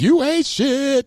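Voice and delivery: high, very slow taunting drawl; playful put-down, laughing along; mock trash talk. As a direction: sneer it at the audience.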